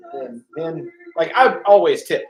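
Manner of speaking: usual speaking voice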